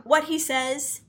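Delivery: normal talking voice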